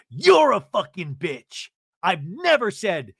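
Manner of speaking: loud, drawn-out retold insult, pitched high; acted outrage. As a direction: spit out the insult as in a fight.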